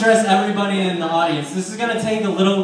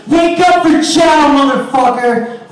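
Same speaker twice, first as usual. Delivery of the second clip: slow bellowed wake-up call, much higher and louder; drill-sergeant bellow; guard shouting; 6 semitones higher, 9 dB louder, slower than usual. Retold or imitated. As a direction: yell it down the cell block.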